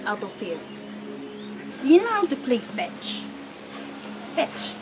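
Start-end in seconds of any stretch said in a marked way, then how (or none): 1.8-4.7 soft puppy talk. Real, not acted. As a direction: coax the puppy gently. Talking softly to puppies.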